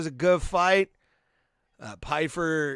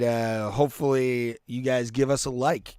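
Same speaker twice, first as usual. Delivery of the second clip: ordinary talk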